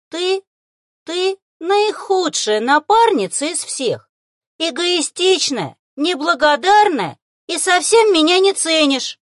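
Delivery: high, loud, slow creature rant; angry outburst. A narrator's reading. Little beast rears up and accuses his partner, angrily. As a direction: hurl each accusation, high and furious. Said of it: "неожиданно зло рявкнул зверёк".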